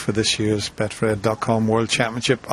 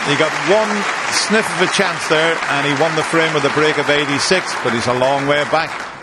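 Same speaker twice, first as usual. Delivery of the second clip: excited; 5 semitones higher, 5 dB louder than usual. Real, not acted. Excited summary after the break.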